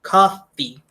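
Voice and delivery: Coffee said alone, carefully, as a model word; model pronunciation. Shown as a demonstration. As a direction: say Coffee once, clearly, for the class to copy. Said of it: "a bebida é coffee".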